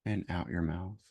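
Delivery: slow, measured meditation voice with long pauses; guided meditation. Real, not acted. Host leading listeners into noticing their judgments, guided meditation voice. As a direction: guide gently, leaving space after each phrase.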